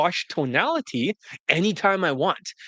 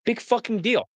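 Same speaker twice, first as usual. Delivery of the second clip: scornful; 6 semitones higher than usual. Real, not acted. Scornful dismissal of rivals.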